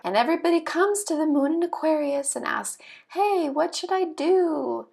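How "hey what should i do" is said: lightly acted higher, slow-drawn plea; advice seeker. Everybody comes to the Moon in Aquarius, mock advice-seeking. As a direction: ask it drawn out, pitched up, mildly hammed. Acting out someone asking for advice.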